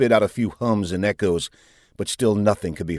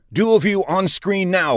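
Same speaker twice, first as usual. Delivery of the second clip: higher, drawn-out command; calling out; 10 semitones higher, slower than usual. A narrator's reading. Commander ordering the view screen changed, calling out. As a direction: call the order across the bridge. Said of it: "called out Safinger".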